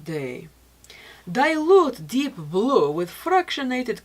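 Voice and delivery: slow, measured usage-instructions read; unhurried product-info narration. Acted. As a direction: read the label directions like a calm ad. Reading product usage directions.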